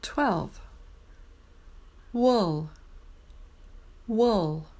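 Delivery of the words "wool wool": wool said with round lips opening into the L; careful wool. Shown as a demonstration. Teacher showing students how to say wool. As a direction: model wool, lips rounded then unrounded into L. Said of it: "start with round lips and then unround".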